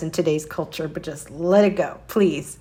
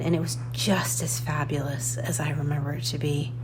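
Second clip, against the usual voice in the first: breathy excited whisper; 3 semitones lower, 7 dB quieter than usual. Real, not acted. Whispering to viewers about the store. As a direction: hush it like a secret delight.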